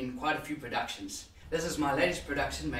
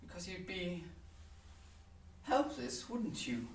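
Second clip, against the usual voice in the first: softer, hesitant thinking aloud; musing monologue character; 2 semitones higher, 8 dB quieter than usual. Acted. Musing on being dead in a box, quietly uneasy. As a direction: quietly puzzle it out, with long pauses.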